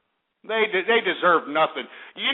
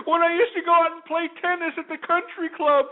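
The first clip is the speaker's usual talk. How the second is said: much higher, slow, whimpering mock sob; mock crying; 11 semitones higher than usual. Mocking a crying Madoff victim on TV, hammed-up sobbing. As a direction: ham up a sobbing rich woman. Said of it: "she was sitting there crying".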